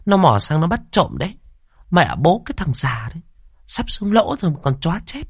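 high, sharp-voiced village shrew grumbling to herself; spiteful neighbour woman. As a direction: mutter like a suspicious old neighbour woman. Liên's angry inner grumbling.

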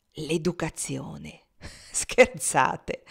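slow mocking echo, then a laugh; amused laugh. He laughs at his cousin's suggestion, amused. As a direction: scoff with a laugh.

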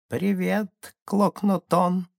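высокий, протяжный голосок маленького шамана; говори высоко и тонко, как далёкий треск кузнечика; тонкий слабый голосок. A narrator's reading. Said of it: "тонкий голосок которого был похож на слабый". (тонкий высокий голосок)

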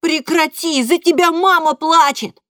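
high, loud yell; boy shouting. A narrator's reading. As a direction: scream at father. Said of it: "кричу я на папу".

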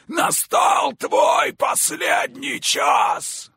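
demonic yell. Acted. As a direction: roar the doom at her. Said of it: "заорал демон".

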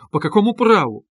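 high, loud indignant challenge; offended cry. A narrator's reading. Offended sister challenging her brother, crying out. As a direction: challenge him sharply, pitch and volume up. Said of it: "вскричала обиженная девушка".

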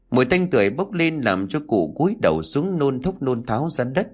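plain reading voice

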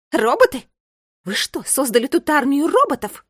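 high, loud incredulous outburst; outraged. A narrator's reading. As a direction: fire the questions out in outrage. Outraged intruder confronts the children. (громкое гневное возмущение)

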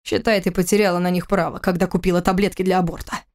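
plain reading voice

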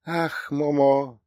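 put-on high, slow old lady voice, doting; умилённая старушка. A narrator's reading. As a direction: draw it out fondly, pitched high. Old woman dotes on the dog. (умилённый, протяжный старушечий голос)

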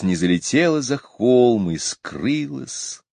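usual speaking voice

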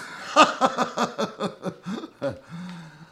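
a long, amused high laugh; hearty laugh. Acted. Inspector laughing at his own quip, hearty laugh. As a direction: laugh out loud at your own joke.

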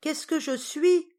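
high, loud, drawn-out query; deaf old aunt. A narrator's reading. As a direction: ask loudly as if hard of hearing. Aunt feigns deafness, asks back.

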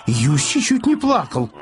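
ordinary delivery, nothing marked

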